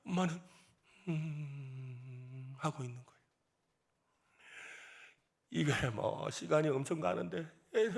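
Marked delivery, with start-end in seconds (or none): 1.1-2.5 long, low, drawn-out "hmm"; imitated puzzled hum. Mother failing to follow her son, imitated long low hum. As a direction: hum slowly as if not understanding.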